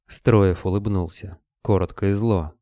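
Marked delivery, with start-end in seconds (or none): none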